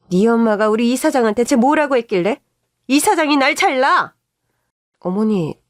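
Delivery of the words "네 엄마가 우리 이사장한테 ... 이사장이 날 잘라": angry. Acted. Mother-in-law yelling on phone.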